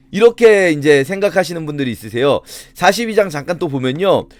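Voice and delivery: ordinary delivery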